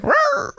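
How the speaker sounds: very high squeaky mock growl; mock dog roar. Friend imitating neighbor's puppy with a squeaky roar. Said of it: "started to be like".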